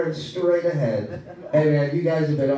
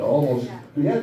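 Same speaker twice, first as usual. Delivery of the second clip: ordinary talk, nothing marked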